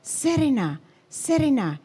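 high, falling calls; acted-out excited lookout cry; imitated calling out. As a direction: call out as if sighting something at sea. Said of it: "I could hear them calling out".

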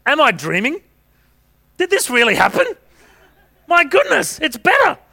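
high-pitched, hammed-up astonishment; amazed. Healed man finds his leg has grown, astonished.

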